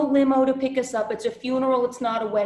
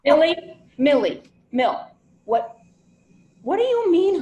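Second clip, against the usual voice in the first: loud, higher, cutting through the noise; calling out loud; 7 semitones higher, 8 dB louder than usual. Real, not acted. Niece yelling down the phone at hysterical aunt, loud. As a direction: shout to get her attention.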